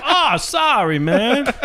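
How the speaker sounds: fake apology. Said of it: "pretend to trip and grab you".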